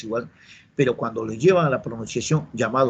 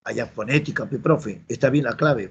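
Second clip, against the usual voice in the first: usual speaking voice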